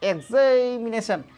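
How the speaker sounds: एग्जामिनेशन said slowly in full; teacher model of the right form; correct pronunciation. Shown as a demonstration. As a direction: pronounce एग्जामिनेशन slowly and completely. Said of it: "एग्जामिनेशन बोलिए तो".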